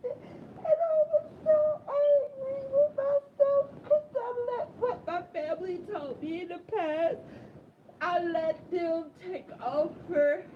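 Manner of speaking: sobbing out self-blame; crying in anger. Real, not acted. Blaming himself for listening to family, crying.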